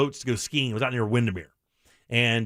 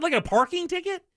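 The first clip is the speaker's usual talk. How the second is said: insulted; 14 semitones higher than usual. Retold or imitated. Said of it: "You sound so insulted".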